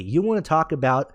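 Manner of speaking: usual speaking voice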